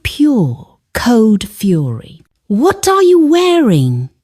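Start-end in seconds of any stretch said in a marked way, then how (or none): 2.5-4.1 high-pitched, indignant demand; harsh demand. A narrator's reading. Son confronting his mother over her dress, harsh demand. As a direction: demand it sharply, pitched well up. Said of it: "he demanded, his voice a harsh whisper".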